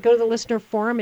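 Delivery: ordinary delivery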